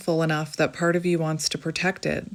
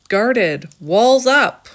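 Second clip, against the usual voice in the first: emphatic; 4 semitones higher, 9 dB louder, much slower than usual. Real, not acted. Describing guarded energy, laughing.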